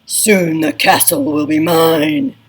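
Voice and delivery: menacing villain. Acted. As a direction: scheme it like a storybook baddie. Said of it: "say it in a voice to match who's talking".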